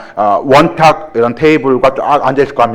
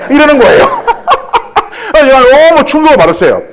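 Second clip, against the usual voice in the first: laughing much higher; 12 semitones higher than usual. Real, not acted. The trainer laughs, recalling a newcomer's jab, much higher. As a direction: laugh through the memory of the shock.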